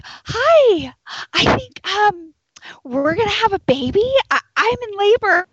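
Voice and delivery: high, small hesitant patient voice; high mock timid woman checking in.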